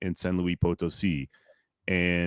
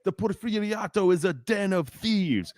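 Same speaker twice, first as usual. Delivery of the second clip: retold yell; 10 semitones higher than usual. Speaker denouncing the regime at a conference, retold yell. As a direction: act out a speaker yelling from the stage. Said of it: "He starts yelling".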